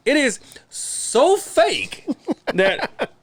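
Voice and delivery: high, slow laughing through the words. Real, not acted. Mocking a staged TV scene, laughing high and slow. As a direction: laugh out the words at how fake it is.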